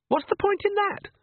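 put-on very high whining girl; sulky teen scoffing; spoilt daughter. A narrator's reading. Daughter scoffs at her brother, whining high.

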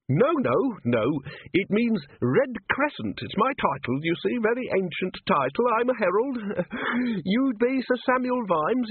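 put-on very high, aghast little old man; scandalized at the very idea; high fluttery herald voice; fussy old herald.

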